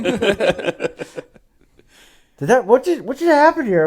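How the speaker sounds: high, loud, stumbling bewilderment; retold panic, tripping over the words; flustered disbelief. Hunter replaying a second miss, flustered and high-pitched.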